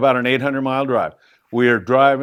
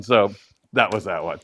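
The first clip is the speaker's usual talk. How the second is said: normal talking voice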